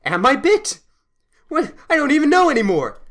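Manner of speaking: high, loud, panicked confusion; hammed-up bewildered freak-out; stunned panic. Stunned man in the corner doubting himself, panicking. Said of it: "That stunned him enough".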